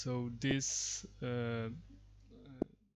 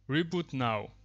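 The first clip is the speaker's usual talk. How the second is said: louder, clearly spoken single word; projecting a command at the microphone; 3 semitones higher, 11 dB louder than usual. Real, not acted. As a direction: call it out crisply so the software picks it up. Commands spoken to speech recognition.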